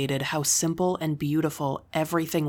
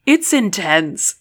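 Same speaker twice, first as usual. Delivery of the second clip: high, loud, slow through laughter; laughing; 4 semitones higher, 7 dB louder, slower than usual. A narrator's reading. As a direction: laugh the words out.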